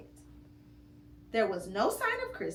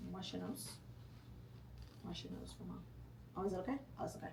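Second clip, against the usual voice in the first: much softer comforting words; tender aside; 4 semitones lower, 16 dB quieter, much faster than usual. Real, not acted. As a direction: soothe the little one quietly. Parent aside to child.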